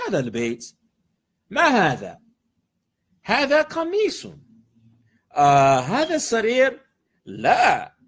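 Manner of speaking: lively read-aloud dialogue, questions pitched high; expressive textbook reading. Acted. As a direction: read the Arabic exchange with feeling, like a little conversation.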